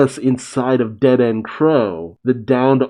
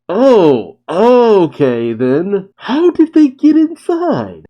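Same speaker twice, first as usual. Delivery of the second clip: excited surprise; 9 semitones higher, 6 dB louder, slower than usual. Real, not acted.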